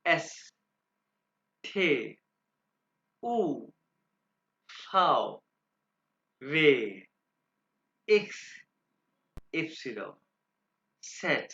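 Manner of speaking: each letter alone, drawn out with falling tune; German alphabet demo. Shown as a demonstration. Teacher models the alphabet for pupils, isolated German letter names. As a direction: recite German letter names one by one, long pauses between. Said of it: "alphabets पढ़ूंगा आपके सामने दो दफा".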